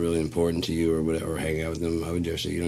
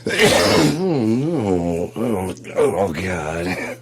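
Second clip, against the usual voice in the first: mock horror; 3 semitones higher, 8 dB louder, much slower than usual.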